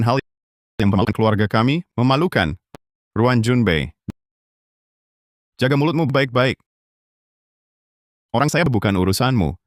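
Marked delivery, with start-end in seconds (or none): none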